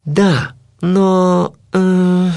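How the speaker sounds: drawn-out, uncertain stalling; reluctant, searching for an excuse; hesitant mumble. A narrator's reading. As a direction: hesitate and trail into a held filler. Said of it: "пробормотал я".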